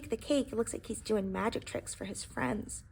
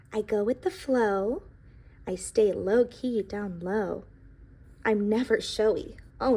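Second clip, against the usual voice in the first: bright, even storybook read, a little louder; storybook read-aloud voice; reading to children; 7 dB louder than usual. A narrator's reading. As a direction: tell the pie's story to a classroom. Reads the book aloud.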